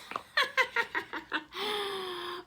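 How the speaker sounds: witchy high cackle; villainous entrance laugh; wicked cackle. Acted. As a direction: cackle like a mean witch arriving. Viola Swamp's entrance laugh.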